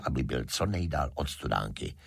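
ordinary delivery

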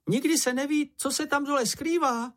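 very high-pitched shouted warning; anxious cry. A narrator's reading. Čenich warns Mumínek heading into the sea, high anxious cry. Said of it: "vykřikl Čenich".

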